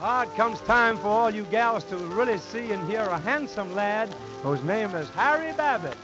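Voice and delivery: usual speaking voice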